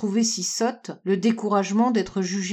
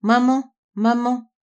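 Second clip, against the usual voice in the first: raised, lightly tearful call; mild broken sob; 3 semitones higher, 4 dB louder than usual. A narrator's reading. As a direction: call out with a touch of heartbreak. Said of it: "d'une voix brisée par les sanglots". (appel plaintif, légèrement brisé)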